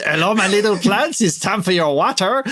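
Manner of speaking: mock French gardener doting on his plants; comedy French accent. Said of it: "talking to the plants in a comedy French accent".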